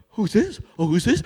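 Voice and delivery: put-on high baby-talk coo; cooing at a stranger. Dog greeting a visitor, high cooing baby talk. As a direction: coo over the newcomer. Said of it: "they're like".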